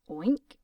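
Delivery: loud oink like a pig. Acted. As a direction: grunt it out like a pig. Doctor oinks like a pig.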